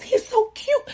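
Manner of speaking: high, soft coo; self-mocking squeal over a baby, kept small; mock gushing. Dazed new mother admiring her baby, mock gushing coo.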